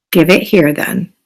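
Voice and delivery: low, weary give-in; low grumbling fish voice; sighing piranha. Acted. Piranha gives in.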